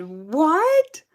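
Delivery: high, loud shocked what rising; inner shocked what. Retold or imitated. Learning the rude lady is manager, loud shocked what. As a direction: let the shock burst out in your head. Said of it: "in my mind, I said".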